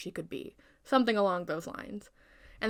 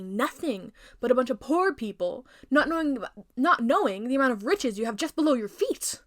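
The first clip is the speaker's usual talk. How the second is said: louder, rising rant; queen's rant; 4 semitones higher, 5 dB louder than usual. Acted. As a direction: build up into a heated rant.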